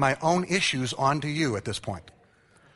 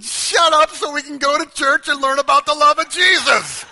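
put-on loud, high shout at the kids; frustrated father shouting in the van; dad yelling; 13 semitones higher, 10 dB louder than usual. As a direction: snap it at the crying kids. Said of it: "And I said".

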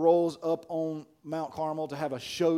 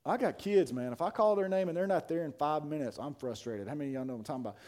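ordinary talk

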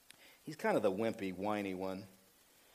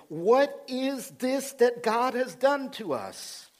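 high, drawn-out cry of dismay; slow, high wail, hammed up in alarm; trembling dismay; 8 semitones higher, 3 dB louder, slower than usual. Preacher voicing the frightened brothers finding the money, trembling dismay. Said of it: "turned trembling to one another, saying".

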